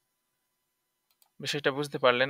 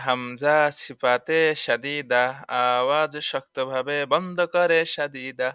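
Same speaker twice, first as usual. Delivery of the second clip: slow sing-song rule recital; slow drilled rule; slower than usual. Shown as a demonstration. Teacher shows students how to recite it slowly. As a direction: spell it out slowly for repeating. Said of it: "এভাবে বলতে পারেন".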